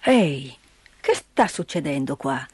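put-on low, grumbling old man's voice; gruff old man. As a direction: sound like a gruff mountain grandfather. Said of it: "esclamò il vecchio".

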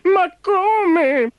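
very high, questioning squeak; puzzled little car. Acted. Little car wondering how to carry Ford, puzzled squeak. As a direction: ask it in a tiny puzzled voice.

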